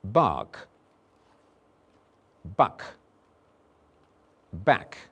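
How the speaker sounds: bark, buck, back said slowly and separately; minimal pair drill. Shown as a demonstration. Teacher drilling vowels for the class. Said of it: "Listen to the next three words".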